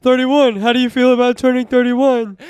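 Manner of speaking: exaggerated interviewer voice; mocking cliché question. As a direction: ham up the birthday question. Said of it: "boring-ass question that everybody gets asked".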